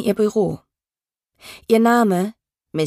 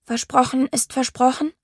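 trembling voice, pitched higher; shaky breathless pleading; 5 semitones higher than usual. Acted. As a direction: plead with a shaking voice. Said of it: "mit zitternder Stimme".

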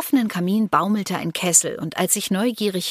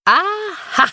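high, loud indignant "aha"; angry; 13 semitones higher, 6 dB louder than usual. A narrator's reading. Glenda discovers the pair's hidden loot, angry. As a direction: pounce on it crossly. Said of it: "sagte Glenda zornig".

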